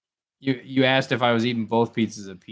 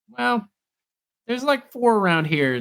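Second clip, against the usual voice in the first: high, chirpy shop lady; imitating ice cream lady; 12 semitones higher, slower than usual. Friend imitating ice cream shop lady, high and chirpy. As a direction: lift into a perky clerk voice. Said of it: "And she's like".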